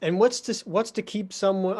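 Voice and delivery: ordinary talk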